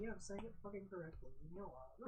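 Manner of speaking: fast grumble that drops to a whisper; irritated fast retort trailing off; annoyed retort fading. Retold or imitated. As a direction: snap back quickly, then mutter leave me alone. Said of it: "It's like".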